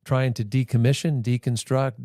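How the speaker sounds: normal talking voice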